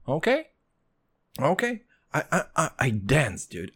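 ordinary delivery

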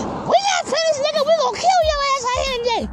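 high squeaky cartoon threat; defiant little dog. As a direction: threaten like a tiny, stubborn lapdog. Pets threaten the tiger.

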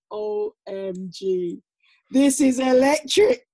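slow, rising to a loud, high exclaim; overwhelmed awe. Retold or imitated. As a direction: let the awe swell into a loud cry. Awestruck entering Olympic stadium.

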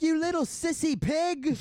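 high, slow, loud insulting chef; mock a raging chef's yell; Gordon Ramsay. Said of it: "He'd be like".

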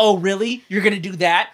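playful high-pitched challenge; mock bickering. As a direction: taunt like a squabbling spouse. Acting out bickering gods.

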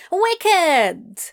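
high, loud cheer. Acted. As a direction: cheer with delight. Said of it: "Adam cheered".

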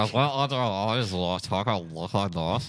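talking through clenched teeth; ventriloquist voice. Shown as a demonstration. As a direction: jaw locked, lips barely moving. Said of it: "it is ventriloquist".

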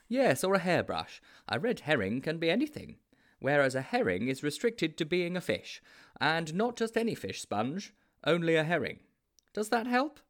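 a hint of a self-appointed detective; Mildew's explaining voice. A narrator's reading. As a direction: explain patiently as the expert friend.

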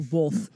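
"Both." said as "bolth" with an added L; imitating bolth. Copying the guest's Nebraska "both", with an L. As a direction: mimic "Both." with the L sound. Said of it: "that's a Nebraska or thing".

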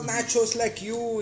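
high imitated Indian-accented English; thick Indian accent. Indian guest ordering from his host, high Indian accent. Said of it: "todavía tiene el acentazo indio".

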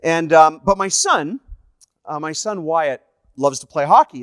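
ordinary talk

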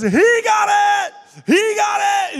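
high, loud triumphant holler; imitated ecstatic yell, stretched out. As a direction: shout it out like an overjoyed kid. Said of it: "he hollers out".

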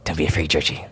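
low creepy coaxing whisper; dark whisper behind you. Pretend. Creepy Pennywise-style luring.

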